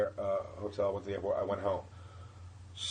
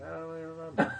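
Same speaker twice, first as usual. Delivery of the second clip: higher, mocking imitation of her reply; put-on higher voice, mock vague; dismissive mimicry; 6 semitones higher, 4 dB quieter, faster than usual. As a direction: mimic her brushing you off.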